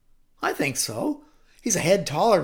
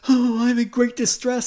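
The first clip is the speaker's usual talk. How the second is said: loud, high, desperate plea; great distress; 7 semitones higher, 9 dB louder than usual. Acted. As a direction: cry out as a distraught king. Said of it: "Saul said".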